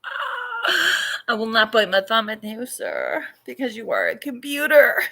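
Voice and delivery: mock-theatrical Shakespeare, laughing through it; faux-noble speech breaking into laughter; hammed-up Shakespearean. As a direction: declaim to the computer like an old stage actor, half laughing. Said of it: "And I'm like".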